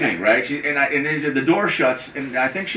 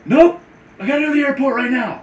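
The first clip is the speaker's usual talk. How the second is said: hurried high voice; 11 semitones higher, faster than usual. Retold or imitated. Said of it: "And she's like".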